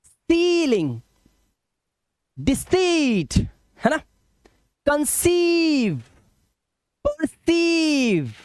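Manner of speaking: सीलिंग, डिसीट, कंसीव, परसीव with high, stretched "ee"; slow word-by-word demo, long high सी; long vowel sound. Shown as a demonstration. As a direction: hold the सी long in each word. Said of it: "long vowel sound देना है".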